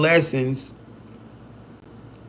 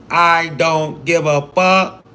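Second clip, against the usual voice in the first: slow, stressed "I don't give a fuck"; drawn-out emphasis; 2 semitones higher, much slower than usual. Real, not acted. Streamer insisting to a viewer, "I don't give a fuck" said slowly word by word.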